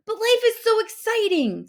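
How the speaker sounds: high, loud, bursting with excitement; a shark who can't contain his joy; excited protest. A narrator's reading.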